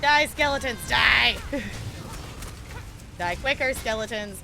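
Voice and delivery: loud playful attack cry; battle yell. Pretend. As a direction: yell it at the enemies. Yelling at game enemies.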